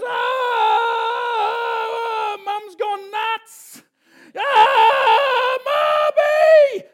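high, loud frantic squeals for mum; panicked screaming eaglet. Acted. Eaglet falling from the nest, shrieking for mum in panic. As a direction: shriek like a baby bird falling.

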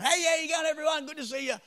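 high, loud chirpy hello; cheery greeting. Retold or imitated. Railway worker greets the morning shift, chirpy and loud. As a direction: bounce in bright and eager. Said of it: "and I'm going".

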